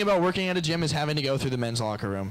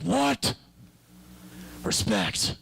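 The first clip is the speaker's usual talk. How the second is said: Batman's respect; much slower than usual. Retold or imitated. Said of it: "Batman just be like".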